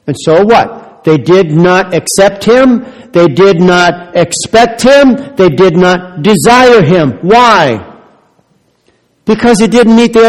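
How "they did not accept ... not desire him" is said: each word slowed and driven home, louder; measured, forceful sermon cadence; slow emphatic preaching. Acted. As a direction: pound out every word like a verdict. Preacher hammering a triple point.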